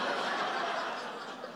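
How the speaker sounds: a short airy laugh; breathy laugh. Real, not acted. Pastor laughs at his own story, a short airy laugh. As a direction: laugh softly at the joke.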